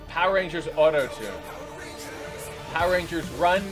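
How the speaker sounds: ordinary talk, nothing marked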